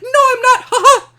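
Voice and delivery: very high, loud squeaky denial with a laugh; squeaky protest. Pretend. Superman denying he sounds like Mickey Mouse, very high and loud. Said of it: "he's like".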